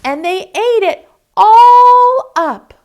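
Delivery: very high, loud, slow squeak; high squeaky voice. Acted. Baby Bear complaining her soup is gone, loud high squeak. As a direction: squeal it like a tiny upset cub. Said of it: "in her high, squeaky voice".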